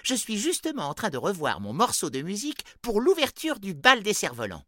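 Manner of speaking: high, loud elf voice; an eager little elf showing off; christmas elf. A narrator's reading. Elf talks about his music.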